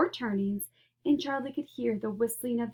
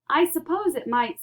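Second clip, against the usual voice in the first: cheerful; 6 semitones higher, 5 dB louder than usual. Acted. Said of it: "cheerful as ever".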